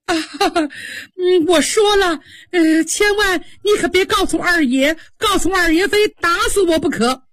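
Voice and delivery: high, tearful begging; high weepy voice, begging not to be beaten; crying maid. Acted. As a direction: plead for mercy through sobs. Frightened maid begs, crying.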